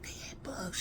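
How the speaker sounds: very soft, unvoiced confiding; whisper. Child explaining to mom in secret, whispering. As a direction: keep it secret, just breath. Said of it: "I'm trying to whisper".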